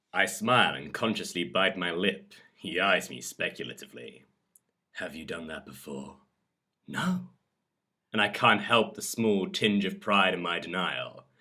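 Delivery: dramatic audiobook reading; measured, expressive storyteller; story narration. A narrator's reading. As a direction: read an intimate romance scene aloud. Reads a story aloud.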